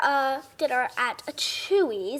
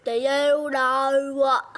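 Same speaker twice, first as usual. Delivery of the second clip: slow, drawn-out attempt at British; attempted British accent; 3 dB louder, slower than usual. Shown as a demonstration. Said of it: "I'm just doing my British accent".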